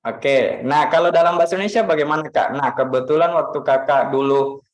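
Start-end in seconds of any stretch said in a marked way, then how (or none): none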